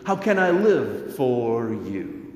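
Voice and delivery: usual speaking voice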